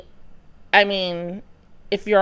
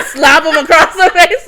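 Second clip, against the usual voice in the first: higher, louder, through laughter; amused and loud, laugh breaking in; 6 semitones higher, 8 dB louder than usual. Real, not acted. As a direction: crack up while telling it. Joking about a mistaken slap.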